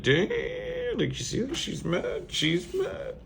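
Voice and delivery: soft, very high falsetto; hushed falsetto teasing; mock alarm. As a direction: squeak it quietly in mock dismay. Mimicking reaction to her storming off.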